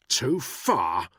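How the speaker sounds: loud contemptuous echo; spat with contempt. A narrator's reading. Danny scorning Buckingham's complaint, loud contempt. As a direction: throw the words back with scorn. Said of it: "Danny spat the words with contempt".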